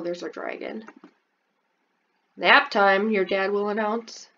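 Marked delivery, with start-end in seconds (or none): none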